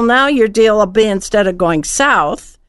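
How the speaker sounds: ordinary talk, nothing marked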